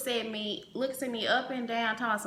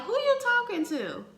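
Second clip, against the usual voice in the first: sassy mom comeback; 12 semitones higher than usual. Retold or imitated. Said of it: "she looked at him with that, like, that look".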